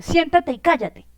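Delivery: sit down and shut up, said in anger; angry command. Shown as a demonstration. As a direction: snap it out like a cross parent.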